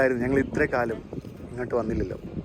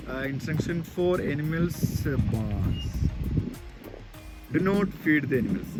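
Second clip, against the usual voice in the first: reading a sign word by word; very slow, careful English; 4 dB quieter, much slower than usual. Real, not acted. Reading sign aloud slowly.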